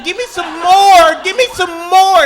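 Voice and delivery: high, loud, slow begging dog voice; drawn-out hammed-up dog plea. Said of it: "looking at me saying".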